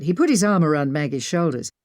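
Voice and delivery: usual speaking voice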